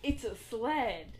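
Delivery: slow, grinning announcement; Mary unveils the gift, slowly and grinning; grinning sled line. Retold or imitated. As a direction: say it slowly with a crazy grin. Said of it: "she has these, like, crazy eyes with a huge grin".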